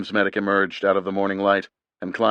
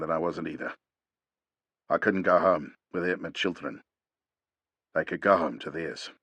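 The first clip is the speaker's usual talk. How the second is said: put-on grieving old warrior; 2 semitones lower, 4 dB quieter than usual. A narrator's reading. Jonathan voiced low and grim.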